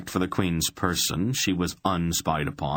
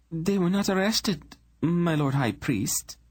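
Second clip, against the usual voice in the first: much higher, composed royal woman; cool queen voice; 12 semitones higher than usual. Acted. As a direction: speak down to him as a calm queen. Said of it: "the Queen replied".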